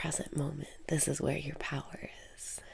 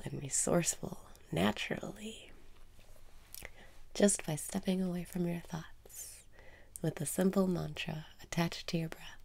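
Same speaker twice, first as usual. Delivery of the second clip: guided meditation. Acted. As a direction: guide the listener inward, half whispering. Guided self-love meditation.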